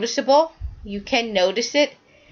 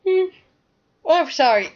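mid-yawn hum and apology; 6 semitones higher, 3 dB louder, faster than usual. Real, not acted. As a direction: talk through a yawn. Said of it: "I do not know why I'm yawning".

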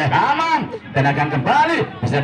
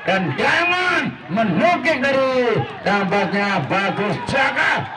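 excited; 5 semitones higher, slower than usual. Real, not acted. After goal, still high.

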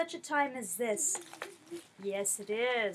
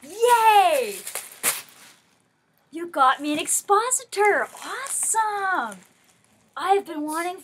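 loud, high delighted cry; excited; 7 semitones higher, 15 dB louder, slower than usual. Real, not acted. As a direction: shout the joy of the gift. Opens long-wanted Bible, cries out.

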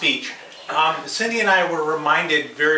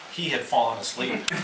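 usual speaking voice

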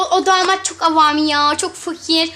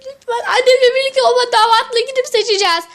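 crying; 5 semitones higher, 3 dB louder than usual. Acted. Ken pleads, crying.

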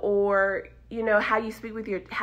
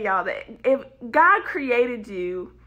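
ordinary talk

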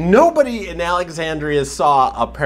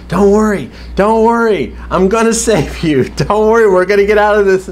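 mock frantic reassurance, higher and louder; Glenn reassuring; 7 semitones higher, 4 dB louder than usual. Glenn panicking on the dumpster, reassuring Nicholas in mock voice. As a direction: imitate Glenn promising rescue. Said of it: "Glenn's like".

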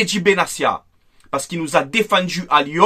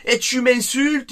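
higher, slow mock accusation; 6 semitones higher, slower than usual. Vlogger mocking [name] yelling at him, higher slow whine. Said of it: "il commence à me crier".

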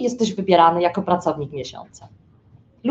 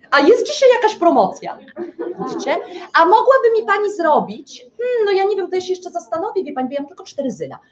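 wyżej, przymilnie, na końcu szybko i cicho; wyciągaj zniżkę słodkim głosikiem; targujący się klient; 6 semitones higher than usual. Retold or imitated. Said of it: "Natomiast Jowisze co robią?". (klient Jowisz wyprasza promocję, wyżej i przymilnie)

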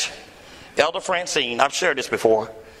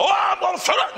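hammed-up sulky quip; mock sulky retort. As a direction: toss off a mock pout.

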